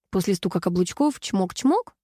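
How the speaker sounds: ordinary delivery, nothing marked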